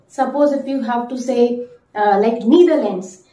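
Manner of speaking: usual speaking voice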